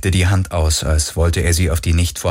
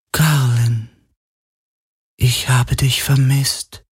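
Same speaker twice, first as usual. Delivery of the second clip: slightly high, slow longing call; a lonely voice calling out; lightly voiced Helix lament; 5 semitones higher, slower than usual. Remembered Helix calls to Galen, slow longing lament.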